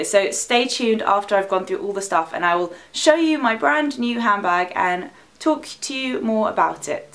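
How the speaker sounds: normal talking voice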